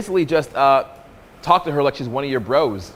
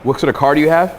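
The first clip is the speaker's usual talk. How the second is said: ordinary talk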